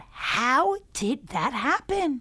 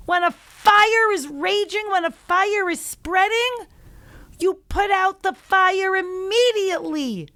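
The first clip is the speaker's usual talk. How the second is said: loud, high, scolding yell; furious shout; 8 semitones higher, 7 dB louder than usual. Acted. Governor shouts at the mayor over the muddy water, furious and loud. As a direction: blast him for being so foolish. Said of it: "shouted at the mayor".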